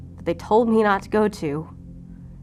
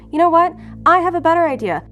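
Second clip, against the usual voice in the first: louder, higher, sarcastic announcement; exasperated outburst; 7 semitones higher, 6 dB louder, faster than usual. Acted. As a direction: raise pitch and volume in fed-up mock enthusiasm. Patient snaps sarcastically at her therapist.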